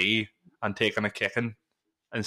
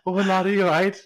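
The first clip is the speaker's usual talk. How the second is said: higher, louder mock concern; concerned passer-by; 8 semitones higher, 5 dB louder than usual. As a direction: imitate a stranger checking on him.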